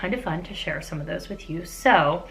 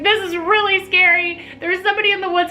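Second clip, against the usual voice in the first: high, loud mock panic; imitating panicked daughter; 12 semitones higher, 4 dB louder than usual. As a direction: squeal it like a scared teen begging. Voicing scared daughter.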